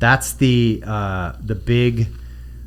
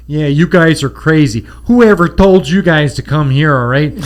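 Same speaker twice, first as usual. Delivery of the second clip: loud Chicago tough guy; Chicago accent; 3 semitones higher, 5 dB louder than usual. Retold or imitated. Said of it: "Chicago accent".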